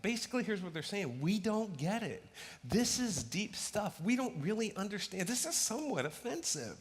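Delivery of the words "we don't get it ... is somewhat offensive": baffled disciples. Acted. Disciples grumble at hard teaching.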